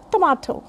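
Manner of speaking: tomato with a long ah, tuh-MAH-toh; correct pronunciation. Shown as a demonstration. Teacher summing up tomato, tuh-MAH-toh. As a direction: open the middle of tomato to ah. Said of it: "the correct pronunciation is".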